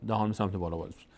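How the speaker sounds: ordinary talk, nothing marked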